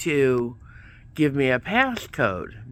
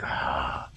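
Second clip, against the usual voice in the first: frustrated groan; 12 semitones lower, 6 dB quieter than usual. Retold or imitated. Frustrated after being hung up on.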